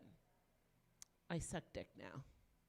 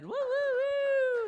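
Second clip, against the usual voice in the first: long, high, loud crowd-rousing whoop; 12 dB louder, much slower than usual. Real, not acted. Comic riles up the audience, loud whooping. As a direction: whoop loudly and high, drawn out.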